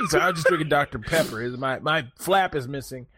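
ordinary delivery

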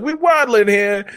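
high mock mobster catchphrase; Italian mobster. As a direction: toss out an imitation of an Italian mob guy. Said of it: "Like, the Italian mob".

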